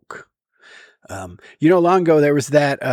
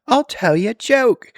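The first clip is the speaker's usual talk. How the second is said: high-pitched mock British accent; 9 semitones higher, 4 dB louder than usual. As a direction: put on an amateurish British voice. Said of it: "I have it doing a British accent".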